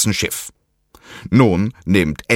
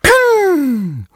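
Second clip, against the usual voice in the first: high sharp ping of a firecracker; imitated bang; 3 dB louder than usual. A narrator's reading. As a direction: pop it out like a bang. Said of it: "ertönt ein lauter Knall".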